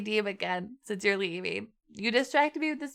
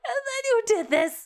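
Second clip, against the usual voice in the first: mock sob; 12 semitones higher than usual. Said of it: "over-exaggerating for effect".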